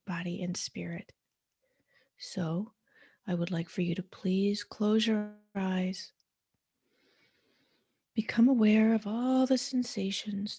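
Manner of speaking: soothing guided meditation voice. Acted. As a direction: ease the listener into stillness, one breath cue at a time.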